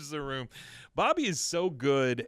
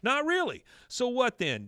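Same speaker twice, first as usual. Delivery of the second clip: high, louder mock interrogation; acted accusing retort; indignant echo; 11 semitones higher, 4 dB louder than usual. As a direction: throw it back like an offended wife. Acting out Peggy mocking and pressing.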